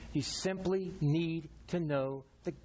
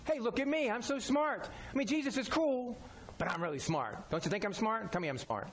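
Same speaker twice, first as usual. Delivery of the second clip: higher, boastful mock voice of a know-it-all; mock show-off; 4 semitones higher than usual. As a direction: ham up a preening show-off begging praise. Mocking a show-off believer.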